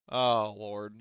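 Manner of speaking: ordinary talk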